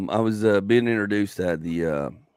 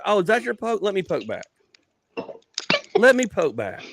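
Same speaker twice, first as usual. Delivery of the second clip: higher-pitched retort, relishing the comeback; mock challenge; 9 semitones higher than usual. Comic answering the host's poke, mock defiant. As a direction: take up the jab playfully.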